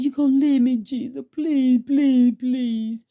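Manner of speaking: high, slow, wailing plea; desperate pleading. Retold or imitated. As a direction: beg Jesus not to go, drawn out and high. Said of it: "I said".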